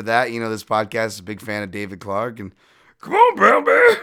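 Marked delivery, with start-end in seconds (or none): 3.0-4.0 much higher, loud cry; cheering shout. Acted. Fan cheering Brown Bear, high loud shout. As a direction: yell from the stands.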